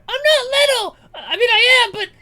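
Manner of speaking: very high, loud indignant squeal with a laugh; flustered orc shout. Acted. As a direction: protest too hard, then backpedal.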